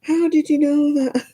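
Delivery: high-pitched amazed question; taken aback, voice shooting up; astonished question. Retold or imitated. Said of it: "And he went".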